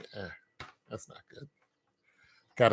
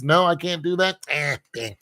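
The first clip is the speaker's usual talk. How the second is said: ordinary talk, nothing marked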